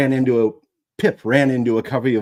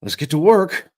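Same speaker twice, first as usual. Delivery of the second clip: put-on boy voice; 8 semitones higher, 7 dB louder than usual. Boy rallies himself.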